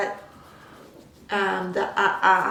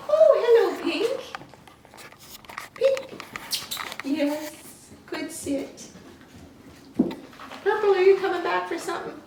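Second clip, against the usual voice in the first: much higher sing-song puppy voice; puppy talk; 9 semitones higher than usual. Real, not acted. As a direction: coo at the puppies, high and sweet. Talking sweetly to puppies.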